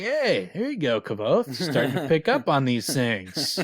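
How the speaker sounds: high, lively teasing praise; mock congratulation. She teases Kvothe for spotting a flirtation, said in high mock praise. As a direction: cheer him on as if he finally caught on.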